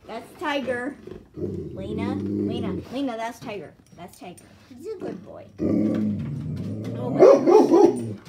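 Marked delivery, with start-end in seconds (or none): 1.4-2.8 low warning calls. Real, not acted. Calling dog sharply, low. 5.6-8.2 much lower, loud, very slow stretched "Oh my gosh"; real startled outburst; shocked gasp. Real, not acted. Startled by barking.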